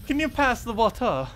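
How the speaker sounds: high, loud posh-dinner "Can you pass the water?"; high giggly mock-formal request; fancy dinner water. Shown as a demonstration. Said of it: "sounds like waiter or water".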